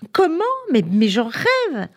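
high burst, then slow, savoured delight; thrilled disbelief. A narrator's reading. Anna invited to the zoo, thrilled. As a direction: leap up in pitch at the invitation.

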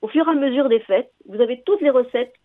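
ordinary delivery

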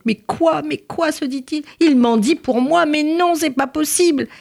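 high, disbelieving protest to himself; ashamed inner panic. Acted. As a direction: panic inwardly, pitched right up. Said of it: "il était rouge de honte". (panique honteuse, voix aiguë)

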